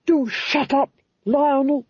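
high, exasperated rebuke to a husband; scolding Duchess. A narrator's reading. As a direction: silence him in a high, imperious voice. Duchess scolds her panicking husband.

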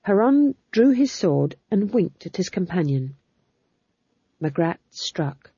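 plain reading voice

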